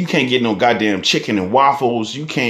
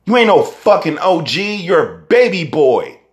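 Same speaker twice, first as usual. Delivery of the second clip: ordinary talk, nothing marked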